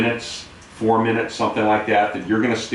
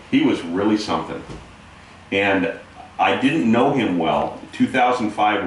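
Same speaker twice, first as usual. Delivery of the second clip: normal talking voice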